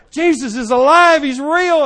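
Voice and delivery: loud, high yelling through the windshield; high, loud urgent yell; retold yelling. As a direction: yell it at her through the glass. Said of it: "I'm yelling at her".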